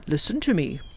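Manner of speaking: firm professor. Acted. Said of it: "said firmly".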